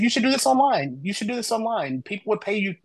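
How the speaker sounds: mildly excited, high-pitched mock urging; retold eager urging. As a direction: act out a friend pushing an idea.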